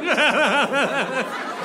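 high, pulsing mocking cackle; imitated loud, obnoxious laughter. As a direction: laugh right in someone's face. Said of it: "the most obnoxious laugh".

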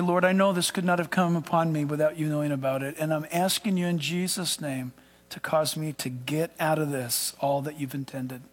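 soft, low model prayer; prayerful. Shown as a demonstration. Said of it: "You just pray".

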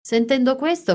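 ordinary delivery

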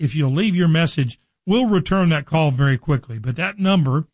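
normal talking voice

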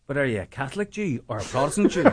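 can't hold back the laugh on the punchline; joke's tough guy, laughing; punchline through laughter. Retold or imitated. Joke punchline, tough guy asks.

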